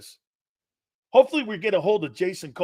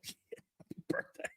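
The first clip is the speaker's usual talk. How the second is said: barely-there breathy whisper, much softer; 18 dB quieter, slower than usual. Retold or imitated. Player whispering happy birthday back to the coach, whispered. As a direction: mumble it under your breath, shy. Mumbling shy thanks to coach.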